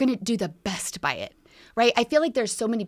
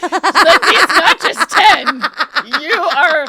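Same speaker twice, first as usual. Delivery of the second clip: laughing; 7 semitones higher, 13 dB louder than usual. Real, not acted. Teasing her friend, laughing loudly.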